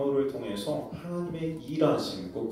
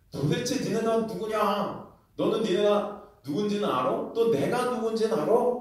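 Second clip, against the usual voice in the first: scolding; 7 semitones higher than usual. Acted. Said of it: "그들을 야단치십니다".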